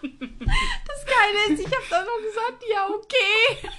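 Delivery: high laughter breaking through the words; can barely get it out for laughing; laughing hard. Real, not acted.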